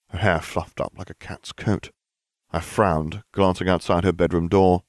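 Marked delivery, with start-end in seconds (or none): none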